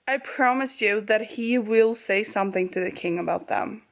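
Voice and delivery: normal talking voice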